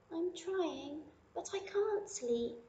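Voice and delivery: high, soft little-bunny complaint; a small bunny who can't sleep; sleepless little bunny. Acted. Lily tells her mother she can't sleep, high and soft.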